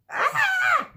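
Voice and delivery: high squeal at moderate volume; mock scream. Teacher enjoys the picture with a high mock scream. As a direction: give a playful, held-back shriek.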